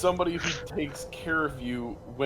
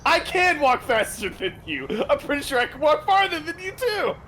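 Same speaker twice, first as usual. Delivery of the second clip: high-pitched, indignant comeback; defiant boast; 12 semitones higher, 4 dB louder than usual. Acted. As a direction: insist competitively, pitch climbing. Boasting back in walking contest.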